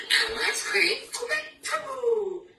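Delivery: squeaky, very high fright; panicked owl squeak. Acted. Owl hearing it is the Gruffalo's food, squealing in fright. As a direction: shriek it as a scared owl.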